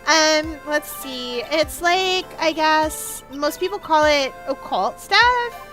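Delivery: put-on slow, drawling Valley Girl accent; hesitant Valley Girl witness. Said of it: "the return of the Valley Girl accent".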